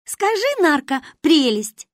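put-on high-pitched, drawn-out girlish enthusiasm; gushing child. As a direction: show off your friend proudly. Manya shows off friend to grandmother.

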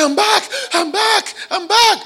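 high, loud, laughing cry of release; joyful shouts. Retold or imitated. Pastor retelling a healed psychiatrist's joy, high excited shouts. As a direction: shout it like a man just set free.

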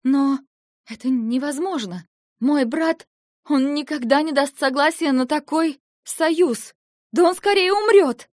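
high, loud, rising to a cry; desperate protest. Acted. Eva refusing Lentar's marriage plan, desperate rising cry. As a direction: build from protest to a near scream.

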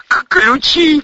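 high, loud stuttering yelp; alarmed high-pitched shout with a stammer; stammered cry. A narrator's reading. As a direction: cry out, tripping on the first sound. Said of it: "крикнул Эдик".

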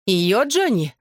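spiteful, incredulous question; hissed disbelief. A narrator's reading. Bella challenges Johnny over the girl, mildly venomous. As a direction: question him with mild venom. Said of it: "прошипела Белла".

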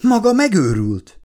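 high-pitched indignant exclamation; outraged. A narrator's reading. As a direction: accuse the captain in disbelief. Outraged woman to captain. (felháborodott, magas hangú kifakadás)

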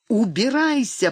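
high-pitched banishing cry; narrated scream of dismissal; angry cry. A narrator's reading. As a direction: cry it sharply, without full volume. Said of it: "продолжала кричать Донна".

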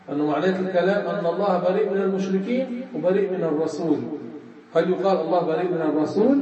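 ordinary delivery

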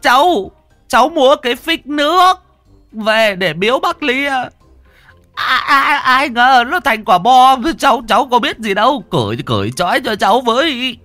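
comic high whimpering plea. A narrator's reading. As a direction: beg to be untied like a scolded child. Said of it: "Gã mếu máo".

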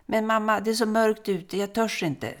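put-on high, pleading little boy voice; a small boy begging, scared of the dark; scared child. Little son begging mother, afraid of the dark outside, high pleading voice.

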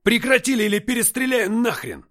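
high, loud threat, full force; commanding shout. A narrator's reading. Commander threatens to shoot everyone, shouting. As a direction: bellow the threat down the stairs. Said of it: "властный голос".

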